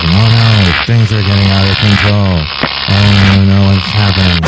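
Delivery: hammed-up alarm as the gadget goes wrong; mock panic. Experiment blows up.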